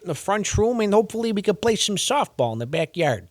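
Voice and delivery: a cheery mock Chicago neighbor; mock Chicago suburban host; Chicago accent. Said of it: "the Chicago accent to me".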